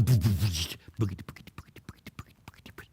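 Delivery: hoofbeat patter fading to a whisper; imitated galloping hoofbeats. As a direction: gallop away with your mouth, fading out.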